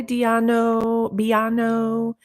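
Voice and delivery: drawn-out name in a light Spanish accent. Real, not acted. Streamer greeting a viewer's name with a mistaken Spanish accent. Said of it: "a friggin' Spanish accent or something".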